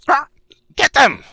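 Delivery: high screeching shout; shrill yell. Acted. GM voices griffin leader yelling.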